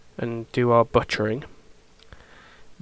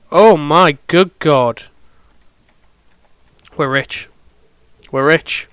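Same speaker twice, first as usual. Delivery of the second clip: loud, slow gasp of delight; shrieking jackpot winner; 2 semitones higher, 7 dB louder, slower than usual. Real, not acted. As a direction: react like you just hit the jackpot. Jade windfall in the game.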